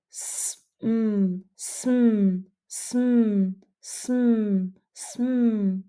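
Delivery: "sm" sounded out and repeated; blend drill. Shown as a demonstration. Teacher sounding out the blend for students to copy. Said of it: "Try it with me".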